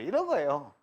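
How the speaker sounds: ordinary talk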